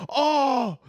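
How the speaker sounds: high, drawn-out comic oh; hammed-up astonishment; mock shock. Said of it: "you're like".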